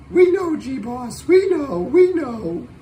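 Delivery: high, playful crowd voice answering back; chirpy viewer voice. Pretend. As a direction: pipe up like the eager viewers.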